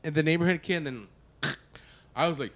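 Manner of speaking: ordinary talk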